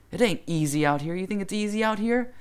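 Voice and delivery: high, loud retold tirade; imitated yelling. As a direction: lay into the kids like a fed-up adult. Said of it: "He's, like, yelling at these kids".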